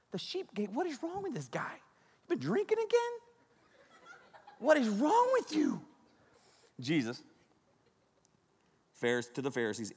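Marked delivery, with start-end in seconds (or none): 0.1-5.8 high, soft squeaky-voiced disbelief; hammed-up incredulous falsetto; baffled listener. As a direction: question it in a small high voice. Acts out baffled listener to Jesus.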